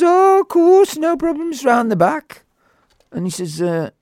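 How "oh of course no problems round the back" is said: very high-pitched acted reply; high, ready yes; breezy agreement. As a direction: imitate an obliging man in a high voice. Acts out obliging boatman's reply.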